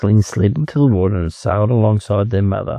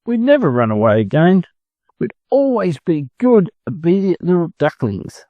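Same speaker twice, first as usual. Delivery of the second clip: crying; 8 semitones higher than usual. Acted. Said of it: "they cried".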